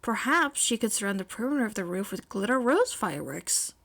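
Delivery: ordinary delivery, nothing marked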